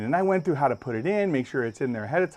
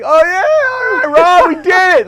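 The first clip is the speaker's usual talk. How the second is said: very high, loud victory whoop; Kevin; 12 dB louder than usual. Retold or imitated. Storyteller voicing his friend celebrating on the bumper, triumphant. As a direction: imitate a triumphant bystander cheering.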